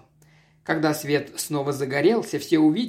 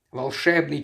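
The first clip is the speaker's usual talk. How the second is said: ordinary delivery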